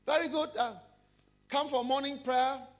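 high, loud, sugary greeting; high, bright banker greeting the office; cheery pious banker. Acted. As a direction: play the too-sweet churchgoer. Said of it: "he said".

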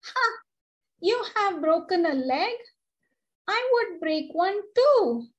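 high, bragging and pleased with herself; smugly. A narrator's reading. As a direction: show off your two legs, teasing. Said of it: "she said smugly".

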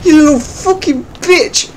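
high-pitched outraged rant; furious screech. Real, not acted. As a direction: scream it at the rival. Opponent beats him again.

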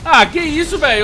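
high, loud outraged question; indignant surprise. Real, not acted. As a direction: protest the glitch. Missile did not fire.

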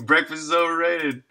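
ordinary talk

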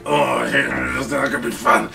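low, slow, muted grumble to himself; dejected dread. Retold or imitated. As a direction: sink into a soft, low dread.

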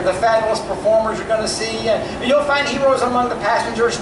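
normal talking voice

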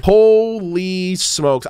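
ordinary talk, nothing marked

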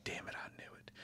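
sinking to a hushed whisper; inner dread. Retold or imitated. Viewer dreading the killer's house, fading to a whisper. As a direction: mutter your fear under your breath. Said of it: "And you're thinking".

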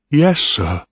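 put-on high, timid boy's reply; afraid. A narrator's reading. Young officer answers the stern captain, afraid.

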